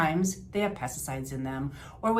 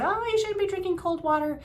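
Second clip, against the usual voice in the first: mock scold; 14 semitones higher than usual. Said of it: "People say".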